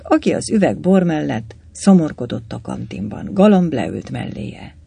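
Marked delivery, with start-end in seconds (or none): none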